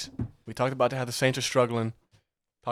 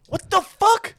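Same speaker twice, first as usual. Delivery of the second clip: outraged surprise; 5 dB louder, slower than usual. Real, not acted. Outraged at being named.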